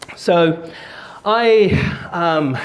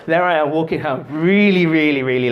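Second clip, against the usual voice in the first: dragging, less jolly retelling; mock despondent. Shown as a demonstration. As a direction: stretch it out with put-on dread. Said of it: "that's a bit too jolly".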